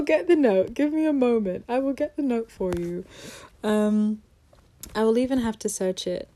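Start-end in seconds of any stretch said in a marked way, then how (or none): none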